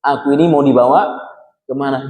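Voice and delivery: usual speaking voice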